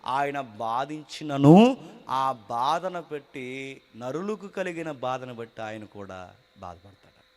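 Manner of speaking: slow, solemn verse reading; scripture reading. Acted. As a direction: read it slow and weighty.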